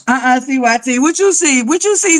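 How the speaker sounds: high, loud eager calling; excited. Real, not acted. Asking a viewer what they saw, excited and loud.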